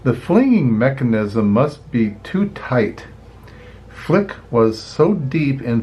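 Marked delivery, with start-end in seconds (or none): none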